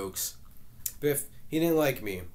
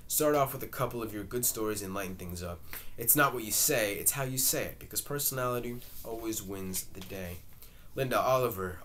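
ordinary delivery

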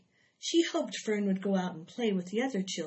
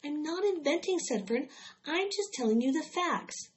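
put-on girl's voice, higher, indignant; a hint of a child insisting; protesting Fern; 8 semitones higher than usual. A narrator's reading. Fern insisting to her mother, lightly indignant.

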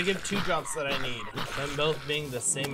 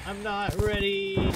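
drawn-out panicked whine; 6 semitones higher, 4 dB louder, slower than usual. Real, not acted.

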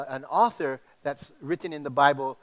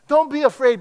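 high and loud, a rallying call; loud preaching; 9 semitones higher, 10 dB louder than usual. Acted. Preacher to congregation, loud rallying call. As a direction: belt it to the church.

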